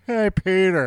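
loud, high catchphrase call; random outburst. Acted. Host showing the sound that pops out, mock holler. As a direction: blurt it out of nowhere. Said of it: "fucking sound comes out".

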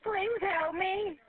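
slow, crying pleas for help; desperate slow pleading. Real, not acted. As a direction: beg for rescue as the water rises. Water past the steering wheel.

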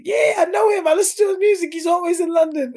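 very high, loud, gushing mimicry; excited friend impression. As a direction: squeal it like an eager fan. Retold friend's eager reply about him.

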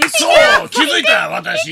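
high, loud mock-excited squeal; excited fan. Fan spotting herself at the ballpark, hammed-up excitement.

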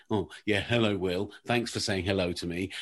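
sulky, hard-done-by imitation; annoyed Simon. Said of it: "Simon gets annoyed at me".

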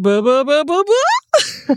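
mocking blah voice. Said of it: "we're both going".

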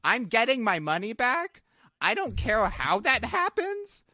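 retold ultimatum. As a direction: lay down each demand, pitch climbing.

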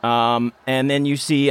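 usual speaking voice

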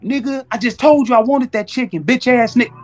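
ordinary talk

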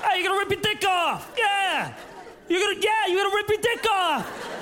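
neighbor calling out. Acted. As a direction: call out across the yard. Neighbor yelling to wife and kid.

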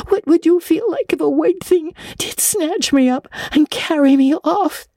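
put-on high, frightened girl pleading; tearful pleading. A narrator's reading. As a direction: beg with fear in the voice. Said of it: "sobbed Anne".

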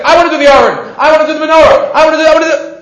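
put-on clamor like eager kids each grabbing a job; mock eager pleading. Kids rushing to grab jobs.

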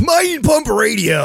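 high, slow announcer shout. Acted. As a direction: belt the show name out. Show intro call-out.

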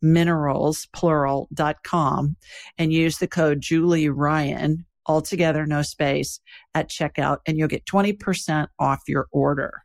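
calm, even sponsor read. Acted. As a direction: read the ad steadily and clearly. Host reads sponsor ad.

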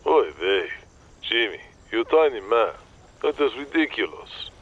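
exasperated Yiddish agent; yiddish accent. Acted. Morty, exasperated.

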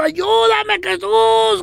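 high mock-desperate cry; comic high wail to heaven; imitated pleading. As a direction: beg for rescue, hammed up. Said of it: "De rodillas y pidiendo".

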